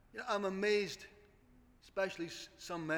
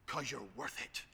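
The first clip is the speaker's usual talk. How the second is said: mock shampoo advert; 6 semitones lower, 6 dB quieter than usual. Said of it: "through a stupid shampoo advert".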